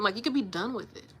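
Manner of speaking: normal talking voice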